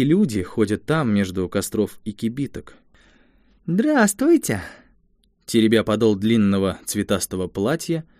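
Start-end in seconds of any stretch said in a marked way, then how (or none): 3.7-4.6 put-on little girl voice.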